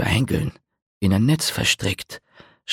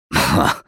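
low, dry scoffing chuckle; short laugh; 6 semitones lower than usual. A narrator's reading. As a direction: scoff at the bard's claim. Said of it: "Na, du kannst ruhig lachen".

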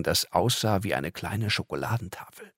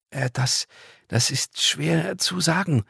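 a hesitant, slow, higher stammer; 8 semitones higher, slower than usual. Acted. Angel caught out by the boy, stammering slowly. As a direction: falter and search for words. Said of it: "stotterte Michaela Cherubina".

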